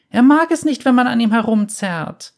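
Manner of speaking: put-on high, loud child's plea; girl's upset cry. A narrator's reading. Girl begs the bounty hunter to stop pulling her bear, high and loud.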